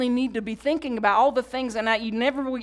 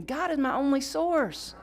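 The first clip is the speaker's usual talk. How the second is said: slow affirmation for the congregation to echo; call and repeat; 5 semitones higher than usual. Shown as a demonstration. Pastor leading the congregation to repeat, slow and clear. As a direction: lead the room in the line. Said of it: "Say that with me".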